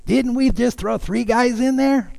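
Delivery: puzzled cartoon gourd with a [name] accent. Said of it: "he's got a [name] accent with a gold tooth".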